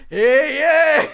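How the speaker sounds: loud imitated bar cheer; mock crowd cheer. Imitating the bar crowd replying, mock cheer. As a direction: shout it like a whole bar answering.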